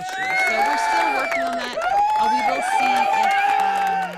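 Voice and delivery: high, loud mock shout; loud playful shout. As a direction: belt it out in a big playful yell. Mock-dramatic shout after health update.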